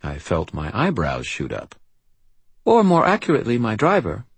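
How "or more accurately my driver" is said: put-on high, precise child's voice with faint British accent; prim British-accented little girl, serious. A narrator's reading. Child describes her bodyguard to the wizard, serious British accent. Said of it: "serious and marked with a faint British accent".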